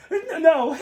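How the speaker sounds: high, loud no; shouting no. Retold or imitated. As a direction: yell it out. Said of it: "Bear's like".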